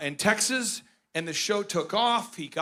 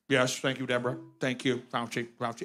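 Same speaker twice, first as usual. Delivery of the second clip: soft, lower thank-yous; Pence impression; 8 semitones lower, 6 dB quieter than usual. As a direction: murmur thanks like a compliant deputy. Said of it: "Pence is".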